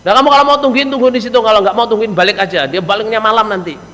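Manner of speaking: starts high and forceful, easing down; brusque scolding run-on from an acted wife; rude wife. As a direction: send the visitor off without any courtesy. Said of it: "bahasanya cewek ini jangan kau wasar".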